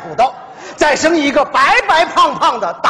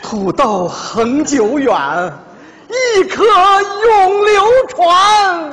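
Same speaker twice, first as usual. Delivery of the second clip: ad slogan; 6 semitones higher, much slower than usual. Pretend. Husband parodying a diamond ad about potatoes. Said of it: "广告词我都想好了".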